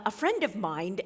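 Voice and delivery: ordinary delivery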